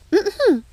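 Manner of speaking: a sharp, high, officious throat clear. Acted. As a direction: clear your throat for the next charge. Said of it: "cleared her throat again".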